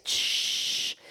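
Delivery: long, drawn-out voiceless shush; hushing shh. Retold or imitated. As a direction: shush a noisy man. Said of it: "la gente le decía".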